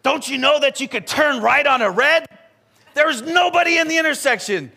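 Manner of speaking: high, loud, indignant lecturing; angry driver. Retold or imitated. Angry driver yelling through his window at the truck, high and loud. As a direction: scold him like an angry driver who thinks you're clueless. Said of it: "And he's like".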